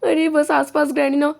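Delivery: ordinary talk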